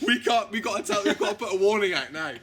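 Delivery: a hammed-up, panicky sing-song warning; mock panic. Fan writer panicking over the script, loud sing-song. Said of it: "he was just like".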